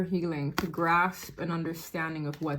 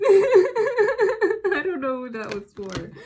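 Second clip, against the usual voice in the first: laughing; 11 semitones higher, 7 dB louder, slower than usual. Real, not acted. Reader laughing at her own message.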